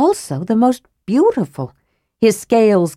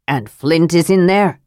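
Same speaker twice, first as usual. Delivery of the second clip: plain reading voice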